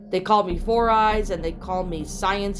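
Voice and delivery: plain reading voice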